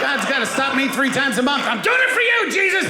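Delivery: mock frantic yell. Parent shouting at teenagers in a mock rage. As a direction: yell it like a dad losing it. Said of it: "joking about his teenagers".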